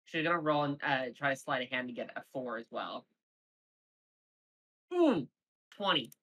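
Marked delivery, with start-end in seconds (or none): 4.9-6.1 excited ooh. Real, not acted. Reacting to rolling a twenty.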